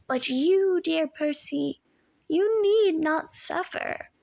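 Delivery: slow purring menace, dropping to a whisper; comforting purr. A narrator's reading. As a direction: lull him with false comfort. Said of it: "modulated back to a comforting purr".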